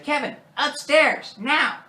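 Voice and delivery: high, loud, drawn-out mock order; stern parent impression. Parent sending Kevin upstairs, mock stern command. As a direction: order a child off as a hammed-up parent.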